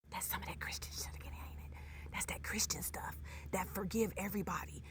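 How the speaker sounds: hushed sarcastic aside; whispered aside. Real, not acted. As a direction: whisper it like gossip.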